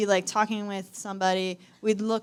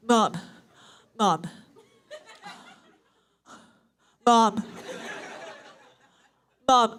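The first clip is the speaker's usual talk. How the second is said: loud, insistent mock kid calling; kid in snorkel mask; 2 semitones higher, 4 dB louder than usual. Sister calling mom from her snorkel mask, loud, insistent. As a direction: pester loudly through an imagined snorkel mask. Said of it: "she had this snorkel mask on".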